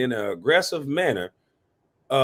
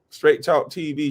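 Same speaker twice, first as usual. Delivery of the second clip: ordinary talk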